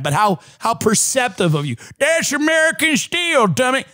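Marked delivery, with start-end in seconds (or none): 2.0-3.8 defensive commenter. Retold or imitated. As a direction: scold him like an offended local. Mocking commenter again.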